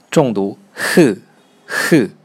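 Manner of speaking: h pushed out slowly from the throat as a breathy puff; strong aspirated h. Shown as a demonstration. Teacher demonstrates the throat sound h, slow and aspirated.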